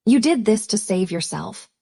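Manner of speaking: ordinary delivery, nothing marked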